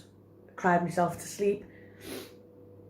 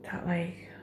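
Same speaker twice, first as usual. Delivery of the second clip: whisper; 8 dB quieter than usual. Real, not acted. Whispered while thinking aloud.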